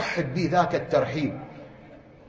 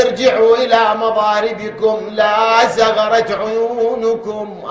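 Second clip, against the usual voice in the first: loud, drawn-out command chanted from the pulpit; a firm elder sends the men back, loud and slow; commanding call; 4 semitones higher, 8 dB louder, slower than usual. Acted. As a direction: order them back with a slow ringing voice. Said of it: "حبيب قال له".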